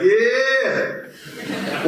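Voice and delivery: high, laughing yeah; pretending to celebrate the forgiven debt; joyful cheer. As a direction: whoop with delight. Imagined joyful reaction.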